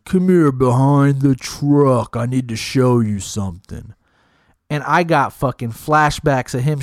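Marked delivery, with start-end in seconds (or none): 0.0-3.9 drawn-out, slowed-down words; eerie slow-mo menace; slow motion. Retold or imitated. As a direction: stretch it like a slow-motion replay. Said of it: "and it was, like, slow motion".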